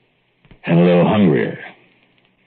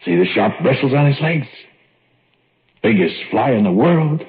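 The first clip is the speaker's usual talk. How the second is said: higher, proud gloating; gloating pride in the monster; 7 semitones higher than usual. Acted. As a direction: boast about the pet. Boasting of giant fly.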